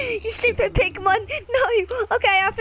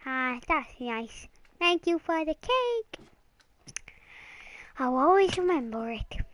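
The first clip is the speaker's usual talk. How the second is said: pretend tender; 2 semitones lower, 6 dB quieter than usual. Child thanking pretend dad for cake, softly sentimental. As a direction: savour it like a treasured gift.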